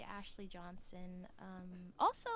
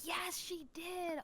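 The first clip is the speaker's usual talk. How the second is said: high, loud, drawn-out delight; excited recognition; 7 semitones higher, 5 dB louder, much slower than usual. Real, not acted. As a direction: stretch it out, thrilled to be reminded. Delighted at remembering a forgotten role.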